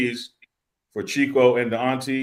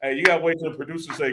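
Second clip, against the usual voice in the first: ordinary talk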